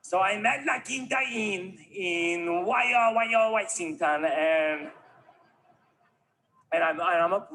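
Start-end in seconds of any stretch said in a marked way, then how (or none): 2.6-4.2 exaggerated Spanish accent, pitched up and drawn out; mock Spanish accent. Comedian giving in to the correctors, mock Spanish accent. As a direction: ham up the Spanish pronunciation slowly. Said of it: "You can't force me to speak Spanish".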